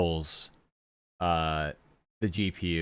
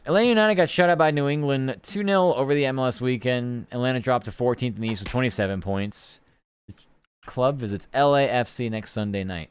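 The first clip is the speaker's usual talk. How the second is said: sports news read; 5 semitones higher, 3 dB louder than usual. Acted. Reading sports news aloud.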